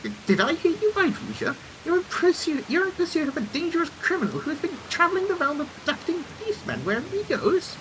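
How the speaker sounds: high, theatrical disbelief; astonished engineer character. Acted. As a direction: play an inventor taken aback by grave news. Voices engineer, astonished at the news.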